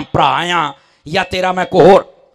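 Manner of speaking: ordinary delivery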